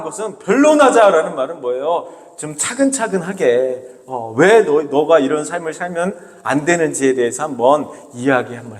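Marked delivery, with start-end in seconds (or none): none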